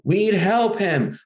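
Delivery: drawn-out class chorus; kids answering. Retold or imitated. Class of kids answering the author together, slowly.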